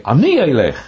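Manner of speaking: "Ani" high and loud; stress on ANI. Shown as a demonstration. Teacher showing Hebrew emphasis, "Ani" stressed. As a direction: punch "Ani" before letting "elech" fall. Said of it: "I'm giving emphasis".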